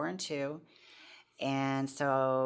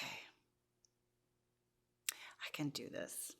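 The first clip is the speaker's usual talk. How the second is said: whispered resolve; 10 dB quieter, faster than usual. Retold or imitated. Quiet resolve remembered in rehab.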